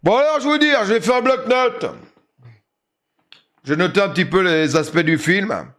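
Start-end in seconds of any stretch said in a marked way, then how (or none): 3.6-5.7 school presentation read. Acted. Reads out a film essay.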